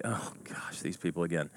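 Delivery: half-whispered, low groan of annoyance; soft, exasperated aside; weary dread. Acted. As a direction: mutter it under your breath, fed up. Imagined weary thought at group.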